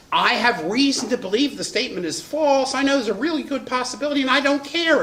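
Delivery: high-pitched, defiant mock confession; brazen reckless publisher. Publisher admitting he doubts the story, brazenly careless.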